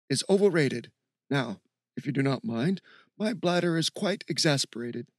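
lilting, stuffy academic voice; stuffy professor. Acted. Steve excusing himself to pee, stuffy professor. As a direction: play the pompous professor. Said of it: "said in a stuffy professor's voice".

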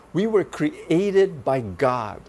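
ordinary talk

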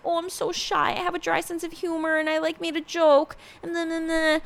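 high, put-on coy girl voice; mock shy act. Vlogger mimicking a contestant's little act, mock shy. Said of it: "she did that whole little act".

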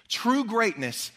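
"True greatness" drawn out slow and high; weighty slow phrase; slow emphasis. Real, not acted. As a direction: land "True greatness" slowly. Sets up the key sentence.

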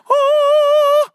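sudden squeal of delight; delighted high whoop. Real, not acted. Kids raise hands.

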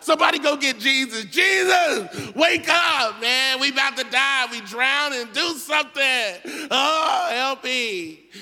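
high, loud panicked shouting; terrified disciples yelling for help; panicked screaming. Acted. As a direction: scream like you're about to drown. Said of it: "they start screaming like girls".

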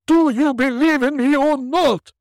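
high, loud wailing ghost; unearthly voice. Acted. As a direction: wail like a tormented spirit.

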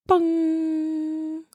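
high, long, held bong like a chime; imitated bong. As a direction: ring it out like a meditation timer chime. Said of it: "It goes".

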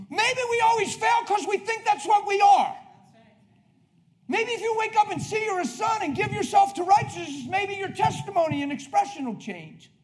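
high, loud preacher's exhortation; full-force preaching. Real, not acted. Preacher urging believers to trust grace, full-force preaching. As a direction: press the point home loudly.

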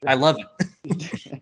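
ordinary delivery

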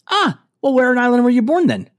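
high, loud Irish lilt; Irish girl. Retold or imitated. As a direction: mimic an Irish girl's questioning. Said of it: "one of the girls goes".

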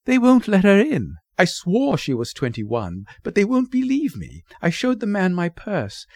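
high, breathless-sounding rush of grievance and pleading; excitable, imploring mother; flustered mother. Acted. Mother pours out her troubles.

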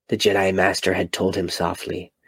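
ordinary delivery, nothing marked

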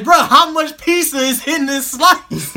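high, loud retold exclamation; amused disbelief. Acted stoned disbelief over pizza.

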